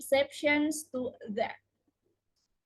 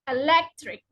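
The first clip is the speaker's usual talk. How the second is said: eLECtric, strong LEC; stress before suffix; 4 dB louder than usual. Shown as a demonstration. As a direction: stress the second syllable of electric. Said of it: "the syllable just before the suffix".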